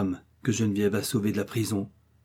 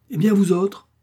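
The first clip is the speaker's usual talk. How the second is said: much higher and louder call to the room; indignant call; 9 semitones higher, 6 dB louder than usual. A narrator's reading. Old woman confronting the household, indignant call. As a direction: challenge them all at once. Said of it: "leur dit-elle".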